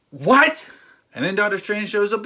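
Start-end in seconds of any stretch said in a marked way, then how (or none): 0.1-0.7 much higher, loud what; incredulous what. Real, not acted. At the plot making no sense, an incredulous outburst. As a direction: blurt it in disbelief.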